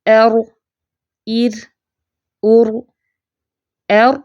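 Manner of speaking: ار with a short light trill on the ر; ار said as a lesson example; sakin r demo. Shown as a demonstration. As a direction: model the silent-vowel ر crisply. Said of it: "جب را ساکن ہوگا، کیسے پڑھیں گے؟".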